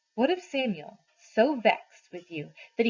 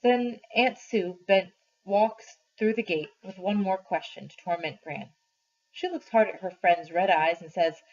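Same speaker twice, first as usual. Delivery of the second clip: ordinary delivery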